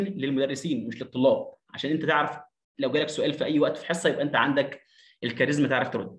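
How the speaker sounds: normal talking voice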